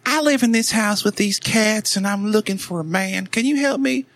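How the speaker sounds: high, pleading lonely woman; squeaky desperate caller voice; high cat lady voice. Retold or imitated.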